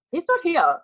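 high answer from the mom. Retold or imitated. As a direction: pitch it up as his mom.

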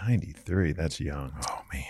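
very soft, low mutter; muttering to himself. Real, not acted. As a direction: think aloud under your breath. Muttering to himself over the clue.

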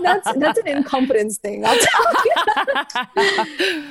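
laughing, slow at the end; chuckling through a joke. Real, not acted. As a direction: laugh out the last words. Joking about not swimming.

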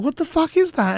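high-pitched, baffled retelling; startled disbelief. Acted. Woman on a date hearing a sudden alarm, acted high-pitched bewilderment. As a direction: act out her baffled reaction.

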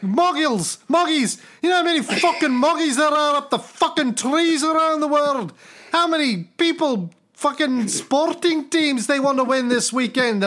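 put-on high, exasperated rant in Scottish accent; Scottish God. God griping about endless miracle requests, exasperated Scottish accent. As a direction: complain like an overworked, put-upon Scotsman. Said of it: "He must be Scottish".

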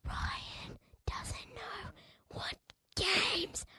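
slow teasing whisper. Real, not acted. Boy teasing his friend, slow whisper. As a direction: whisper it behind his back.

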